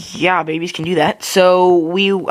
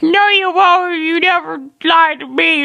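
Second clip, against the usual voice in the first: mock tantrum; 13 semitones higher, 4 dB louder than usual. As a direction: bawl in exaggerated baby protest. Acts toddler bawling at parents.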